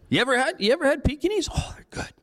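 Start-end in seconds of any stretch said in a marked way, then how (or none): 1.5-2.1 soft conspiratorial whisper; whispered aside. Acted. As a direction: hush it like a naughty secret. Mock aside about eating dogs.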